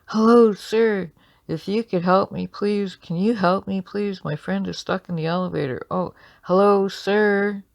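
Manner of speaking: loud, high-pitched plea for help; yelling out. Acted. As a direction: call urgently after someone down the hall. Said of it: "you yell out".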